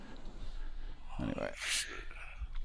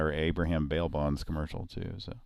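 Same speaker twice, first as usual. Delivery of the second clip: ordinary delivery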